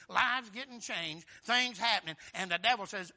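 normal talking voice

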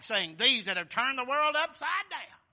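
scripture read as a loud cry; crowd crying out. A narrator's reading. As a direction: call it out like an angry mob. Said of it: "They cried".

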